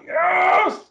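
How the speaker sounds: sudden high, loud yes; triumphant shout of joy; excited cheer. Retold or imitated. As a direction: whoop it like a kid who just got a treat. Cheering at Reese's arriving in England.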